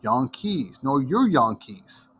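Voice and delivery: put-on slow retort in a thick Dutch accent, Jan Kees said yahn kees; mock Dutch comeback, drawn out; slow Dutch-accented playground retort. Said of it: "they had this really thick accent".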